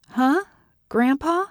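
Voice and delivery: high-pitched, questioning disbelief; baffled, rising question; puzzled surprise. A narrator's reading. Puzzled by unexpected phone caller.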